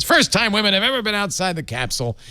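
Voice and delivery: high-pitched, badly faked Trump voice; terrible Trump. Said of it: "That was a terrible Trump".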